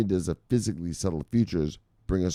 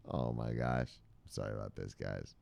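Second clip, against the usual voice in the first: quiet apology; 10 semitones lower, 14 dB quieter than usual. Real, not acted. Embarrassed aside.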